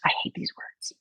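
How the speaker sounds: whispered scorn; boss's whispered scorn; muttered complaint. Retold or imitated. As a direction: hiss it with disdain. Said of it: "he goes".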